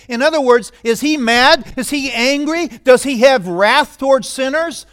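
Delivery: pulpit-pounding rhetorical questions; preaching at full force. Acted. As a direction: hammer each question at the congregation. Preaching climax on God's wrath.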